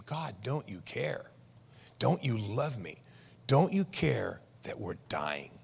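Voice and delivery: soft, slow, low accusing plea. Acted. Disciples accusing Jesus as they face death, hushed reproach. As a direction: drop the volume right down and plead slowly. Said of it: "Now they're accusing him".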